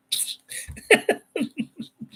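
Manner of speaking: high breathy burst of laughter. Real, not acted.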